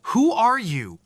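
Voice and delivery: high, frightened cry out. A narrator's reading. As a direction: call out in fear. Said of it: "she cried".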